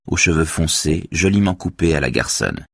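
plain reading voice

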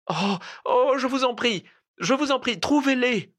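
high-pitched, tearful begging; anguished pleading cry. A narrator's reading. Desperate cousin begging him for the money, anguished high cry. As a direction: plead in pained distress, voice way up. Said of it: "Elle poussa une sorte de cri de souffrance".

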